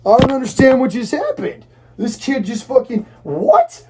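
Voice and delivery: retelling the sergeant major's stunned reaction, loud and high; stunned disbelief. Retold or imitated. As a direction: play the gobsmacked sergeant major who cannot believe it.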